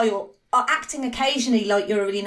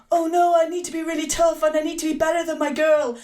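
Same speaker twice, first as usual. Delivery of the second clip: hammed-up insecure tough guy; put-on voice of a jealous, insecure boyfriend; terrible Riley impression; 3 semitones higher than usual. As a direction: do a bad mock of a boyfriend desperate to prove himself. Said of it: "that's a terrible impression of Riley".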